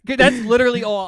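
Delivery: ordinary delivery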